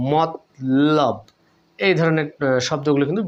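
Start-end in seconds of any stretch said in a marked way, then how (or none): 0.1-1.2 মতলব split slowly as mot, pause, lob; syllable by syllable. Shown as a demonstration. Teacher spelling out an example, slow split syllables. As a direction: slowly close the first half of মতলব on t. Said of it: "আমি লিখতে পারি".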